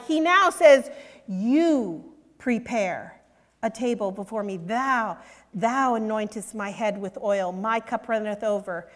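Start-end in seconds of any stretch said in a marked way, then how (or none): 1.3-8.9 reverent psalm reading. Acted. Preacher reciting Psalm 23.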